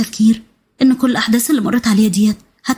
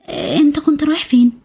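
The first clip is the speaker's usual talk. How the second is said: put-on higher girlish voice, hesitant start; shy, hesitant; 4 semitones higher than usual. A narrator's reading. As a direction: ask timidly, working up the nerve. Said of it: "بصت له بخجل شديد مترددة".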